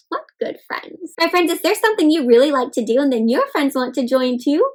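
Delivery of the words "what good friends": whispered aside. Real, not acted. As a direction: breathe it out as a secret. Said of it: "storyteller whispers aside".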